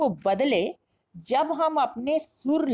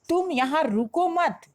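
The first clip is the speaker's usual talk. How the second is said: ordinary delivery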